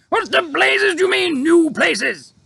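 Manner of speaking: high, loud blustering officer; outraged officer. A narrator's reading. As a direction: Air Force chief shouting at the pilot, outraged. Air Force head voiced.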